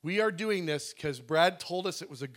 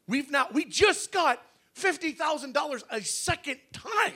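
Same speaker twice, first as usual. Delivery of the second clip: real disbelief; 9 semitones higher than usual. Real, not acted. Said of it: "I can't believe it".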